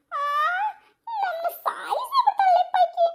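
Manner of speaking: mock lament. Complaining about daughter-in-law.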